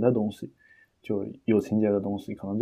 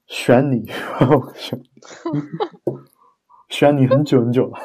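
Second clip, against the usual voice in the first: hammed-up Taiwanese drama accent, louder; Taiwanese idol drama accent; 3 semitones higher, 6 dB louder than usual. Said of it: "台湾偶像剧...说话的调调".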